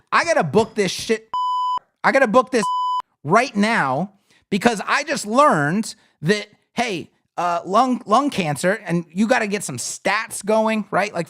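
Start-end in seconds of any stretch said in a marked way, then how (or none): 0.1-1.3 a customer's sudden urge to book, lightly voiced; mock-eager buyer who must book now. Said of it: "from, um, consideration phase to". 2.0-8.8 pushed, urgent "I gotta book this"; louder, urgent customer. Retold or imitated. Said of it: "from, um, consideration phase to".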